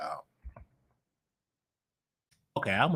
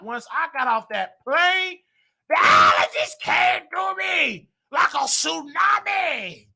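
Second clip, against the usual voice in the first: mock wailing; 11 semitones higher, 4 dB louder, slower than usual. Real, not acted. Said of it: "these allergies is, woo".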